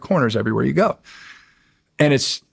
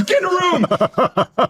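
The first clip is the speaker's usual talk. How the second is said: mock order; slower than usual. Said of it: "She goes".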